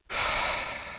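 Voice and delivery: soft, breathy sigh. Real, not acted. Vlogger pondering why we are here, sighing.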